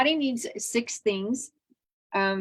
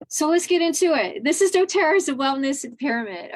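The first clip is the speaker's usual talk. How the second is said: higher and louder, launching the topic; excited kickoff; 6 semitones higher, 4 dB louder than usual. Real, not acted. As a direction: jump in with a burst of energy.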